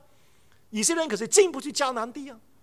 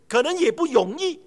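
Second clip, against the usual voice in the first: normal talking voice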